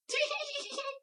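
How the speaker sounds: very high, soft squeaky titter; imitated comedian's noise. Copying a comedian's odd bit, high squeaky noise. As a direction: squeak quietly through a pinched mouth. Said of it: "He does this".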